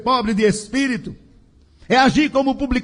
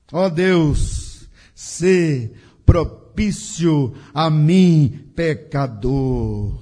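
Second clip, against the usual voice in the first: slow, low plea, sinking deeper at the end; pleading cry to God; 8 semitones lower, much slower than usual. Acted. Preacher voices a sinner's prayer for mercy, slow low plea. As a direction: draw out each phrase as a humble prayer. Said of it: "assim podemos clamar".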